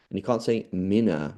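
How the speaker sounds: normal talking voice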